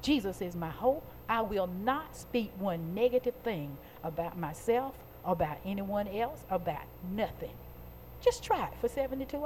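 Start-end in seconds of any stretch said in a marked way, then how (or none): 0.0-7.5 low, soft private resolve; inward self-talk. Acted. Preacher modelling a believer's thought for seventy-two hours, quiet self-talk. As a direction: say it quietly, as if to yourself. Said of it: "think within yourself".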